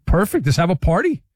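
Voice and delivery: sarcastic. Acted. Sarcastic reply to co-host.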